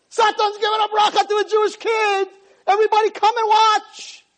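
high, loud crowd-gathering shout; screaming. Acted. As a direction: cry it out to all of heaven. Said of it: "They're screaming".

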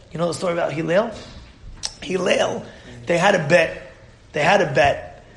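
usual speaking voice

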